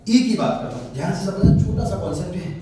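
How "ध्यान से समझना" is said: ordinary delivery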